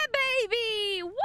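high, drawn-out whoop of triumph; excited cheering. Real, not acted. Player gets the canister in place, excited cheering.

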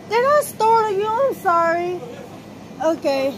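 high, loud, sugary polite voice; polite customer voice. Real, not acted. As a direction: sweeten it up for a stranger. Greets startled stranger in store.

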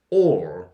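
"all" low and loud, hard at the front; stressed vowel onset. Shown as a demonstration. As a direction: start "all" with a forceful, doubled 오. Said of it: "이런 느낌으로 발음해주세요". (쌍이응 느낌으로 낮고 세게 발음한 all)

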